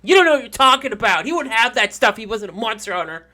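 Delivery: put-on loud, high childish outburst; arguing kid. Another kid defends Vincent against Daniel, loud indignant voice. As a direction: shout the other child down. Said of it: "But another kid's like".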